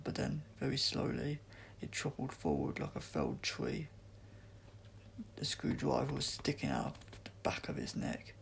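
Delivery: ordinary delivery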